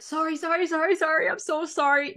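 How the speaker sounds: high, loud, frantic string of sorries; frantic apologizing. Retold or imitated. To whoever is in the car, a loud high panicky apology. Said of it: "I get a super apologetic part".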